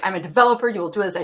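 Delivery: mock commanding. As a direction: play a pompous boss giving orders. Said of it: "And I'm like".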